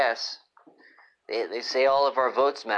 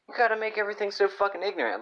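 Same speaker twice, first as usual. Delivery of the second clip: mock whiny voice; 9 semitones higher, faster than usual. Mocking rant voice.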